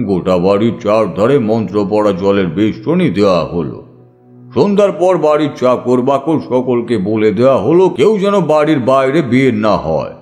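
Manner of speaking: usual speaking voice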